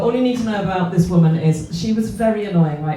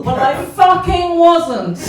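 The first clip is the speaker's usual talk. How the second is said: mock defiant; 6 semitones higher, 7 dB louder, slower than usual. Defiant punchline to crowd.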